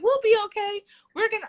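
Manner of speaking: cheerful reassurance. Retold or imitated. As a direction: lift the voice to cheer up a friend. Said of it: "I'm like".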